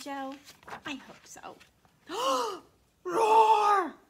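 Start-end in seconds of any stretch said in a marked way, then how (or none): 2.1-2.6 dramatic gasp. Acted. Sudden dinosaur surprise. 3.0-3.9 loud, high mock roar; hammed-up kid-friendly dinosaur roar. Shown as a demonstration. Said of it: "Can you say that?".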